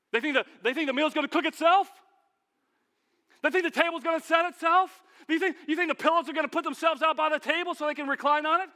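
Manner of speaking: high, loud indignant shouting; angry outburst. Acted. Martha fuming that nobody helps set the table, loud indignant shout. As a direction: shout it out, fed up.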